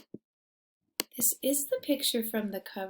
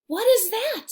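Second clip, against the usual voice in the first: a hint of a nervous bear asking; worried bear; 11 semitones higher, 10 dB louder than usual. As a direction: ask it like a startled bear. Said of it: "Bear mutters".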